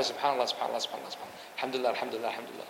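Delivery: low, soft running repetition; imitating someone quietly repeating praises after prayer; murmured dhikr demonstration. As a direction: run the phrases together under your breath. Demonstrates repeated dhikr heard by questioner.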